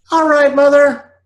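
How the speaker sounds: put-on high, loud child's voice; young [name]. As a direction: answer like an obedient little boy. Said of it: "I said".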